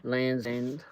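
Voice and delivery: ordinary delivery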